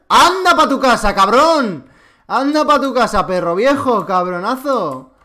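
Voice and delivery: loud taunting yell; mock the enemy you killed; gloating shout. Real, not acted.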